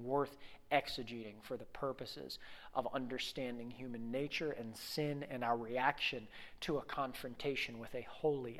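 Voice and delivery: soft, low, earnest prayer. Acted. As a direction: pray it quietly and sincerely. Leads the congregation in prayer.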